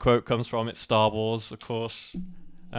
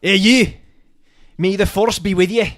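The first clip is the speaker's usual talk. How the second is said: louder, higher hammed-up regional accent; 6 semitones higher, 12 dB louder than usual. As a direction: put on a bold regional British accent, loud and high. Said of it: "You're really good at that".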